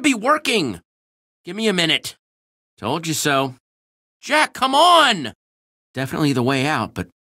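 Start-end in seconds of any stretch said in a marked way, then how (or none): 4.2-5.3 shout. Acted. Urging Jack to hurry.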